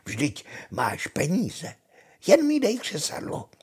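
witch retort rising to very high; snapped retort. A narrator's reading. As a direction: demand it as the witch, climbing high. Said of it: "odsekla čarodějnice". (odsekávající čarodějnice, hlas stoupá vysoko)

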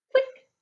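tiny high-pitched "click"; light-switch click. Shown as a demonstration. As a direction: pop it out like a switch sound. Sound effect for the light switch.

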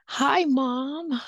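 friendly greeting. Shown as a demonstration. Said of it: "I tell the parent".

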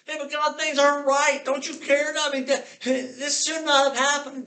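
an upset believer shouting at God while held; angry outburst. Retold or imitated. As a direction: yell at God in hurt anger.

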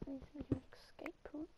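breathy hushed whisper. Real, not acted. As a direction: whisper it under your breath. Quiet aside while playing.

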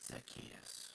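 hushed breath of a name; whispered name. Acted. Preacher dropping the name to a whisper. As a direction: let the name fall to a whisper.

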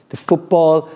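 ordinary delivery

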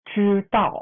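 ชื่อเต่า said high and loud, retroflex ชื่อ; model word. Shown as a demonstration. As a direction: drill ชื่อเต่า loudly for repetition. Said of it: "ลองดูตรงนี้".

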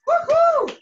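high loud cheer; excited whoop. Real, not acted. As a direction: whoop to pump the class up. Instructor cheering class on.

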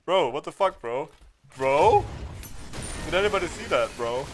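loud amazed calls to chat; excited. Real, not acted. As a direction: shout it out, hyped. Hyped reaction to play.

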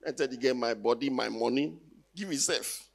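ordinary talk

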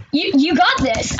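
ordinary delivery

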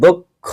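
দক্ষ said as দোক্খো, drawn out; correct pronunciation. Shown as a demonstration. Teacher modelling the word, দক্ষ with closed o. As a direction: give দক্ষ a closed o in both syllables. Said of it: "এটাকে বলবো".

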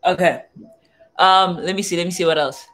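normal talking voice

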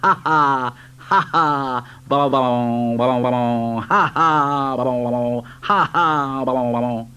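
jeering trucks cackling; mocking laughter. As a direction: laugh and jeer like naughty trucks. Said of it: "And the trucks just laughed".

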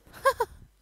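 sudden high laugh; quick amused laugh; burst of laughter. Real, not acted. Laughs at villager in cactus.

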